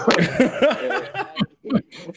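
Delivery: high, bursting laugh; high-pitched burst of laughter; big laugh. Real, not acted. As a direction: laugh out hard at the memory. Laughing at own story.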